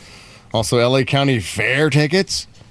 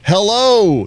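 high, loud radio greeting; host phone greeting; 9 semitones higher, 5 dB louder than usual. Real, not acted. Host shows callers the cue, high loud greeting. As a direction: sing out Hello to the caller. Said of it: "Start your impression now".

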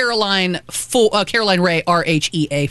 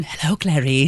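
mock Hannibal Lecter; 5 semitones lower than usual. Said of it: "that's worse than".

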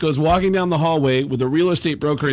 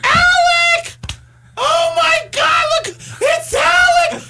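gushing shriek. Retold or imitated. Beverly D'Angelo shrieking at Baldwin in the hallway. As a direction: squeal like a starstruck fan. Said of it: "gets off the elevator and goes".

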